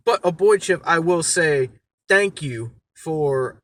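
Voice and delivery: ordinary delivery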